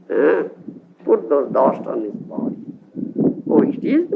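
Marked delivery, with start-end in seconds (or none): none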